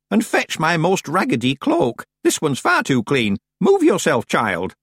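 put-on high, loud, bossy old woman; bossy old witch. A narrator's reading. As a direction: hurry the girl along with orders. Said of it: "Miss Treason demanded".

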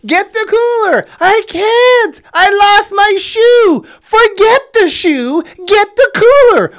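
very high, loud panicked shouting; frantic yelling. Retold or imitated. Dad and mom argue over the floating cooler, frantic yelling. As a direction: yell desperately over the rushing river. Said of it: "So I'm yelling".

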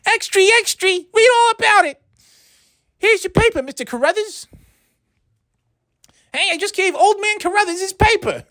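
very high-pitched period newsboy cry; old-time newsie. Acted. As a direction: hawk papers like a hammed-up newsboy. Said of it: "I'm doing a character".

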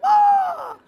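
put-on shrill baby-bird shriek; a sudden high cry of falling; high falling scream. Eaglet knocked off the nest.

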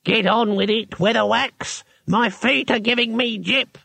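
put-on high, querulous old wizard voice; an impatient old man with sore feet; cranky old wizard. A narrator's reading. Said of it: "the oldest wizard ... spake thusly".

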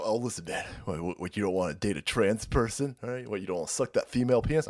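low, mocking pushy voice; lightly voiced condescending persuader; mock pushy advocate. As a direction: mimic a preachy guy talking you into it.

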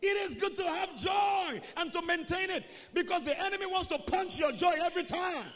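high, loud exhortation; shouted church exhortation; full-force preaching. Real, not acted.

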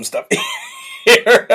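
high squeal of laughter on the word; real giggle-squeezed word; laughing. Real, not acted. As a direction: crack up mid-sentence. Cracking up while mocking.

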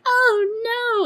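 high, drawn-out horrified cry; mock freak-out. Birdie finding a dead body, mock freak-out. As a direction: wail it in mock dismay. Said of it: "freaks out".

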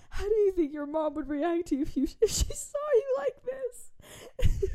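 high, squeaky mom quote through laughter; helpless giggly mock scolding; laughing imitation. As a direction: barely get the scolding out for laughing. Retelling mom's scolding through laughter.

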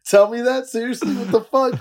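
high, loud disbelieving outburst; incredulous. Retold or imitated. Answering a trash-talk call, high incredulous outburst. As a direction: squeak it out in disbelief. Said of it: "I'm like".

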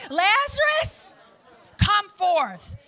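Lazarus stretched high and slow, then come forth; Jesus calling Lazarus. Acted. Preacher voicing Jesus at the tomb, loud summons. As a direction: call the dead man out of the tomb. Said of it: "He said".

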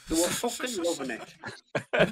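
laughing through the words; slow and amused, laugh in the voice. Real, not acted. Laughing while telling the story.

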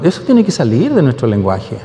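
ordinary delivery

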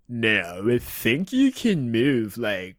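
slow, dopey surfer-dude drawl, lightly done; a laid-back, spaced-out Keanu voice; Keanu Reeves impression. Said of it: "you still did a Keanu Reeves impression".

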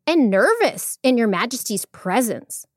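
high, gently persuasive monkey voice; calm little monkey. A narrator's reading. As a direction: coax the queen in a small, high voice. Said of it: "he replied calmly".

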